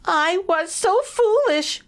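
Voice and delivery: high, slow, tearful mouse voice; crying mouse. A narrator's reading. Elvin regretting leaving home, high and tearful. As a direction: wail it with regret. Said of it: "poor Elvin cried".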